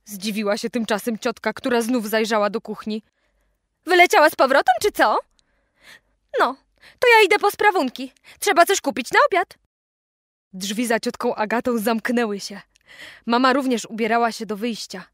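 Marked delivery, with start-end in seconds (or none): 3.8-9.6 aunt voiced higher and louder; bustling aunt off to the shops, loud. A narrator's reading. Aunt heading out to shop, speaking up high.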